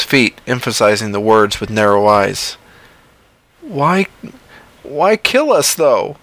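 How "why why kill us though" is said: much higher, strained man's voice; a frightened man begging for an answer; John pleading. Acted. John asks the creature why, high and strained. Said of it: "he asked".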